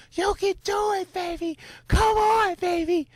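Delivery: high, excited cheering on a runner; cheering crowd. Retold or imitated. Spectator cheering a passing marathon runner, high excited call. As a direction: yell encouragement from the sidelines.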